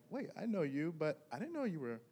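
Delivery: normal talking voice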